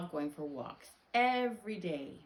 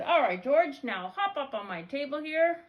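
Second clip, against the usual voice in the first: loud, high, hearty vet voice; vet character; 8 semitones higher, 8 dB louder than usual. Acted. Vet calling George onto his table, loud and high. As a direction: summon the patient brightly and loudly. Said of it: "He said".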